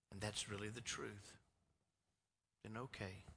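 quiet reassuring plea; tender mom. Retold or imitated. Mother gently asking her son for the truth.

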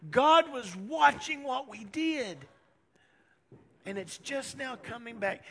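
high-pitched imitation of distressed wailing; tearful lament, acted. Brothers blame each other for selling Joseph, acted tearful. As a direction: wail it in a high, anguished mock cry. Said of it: "they're all crying, and they're saying".

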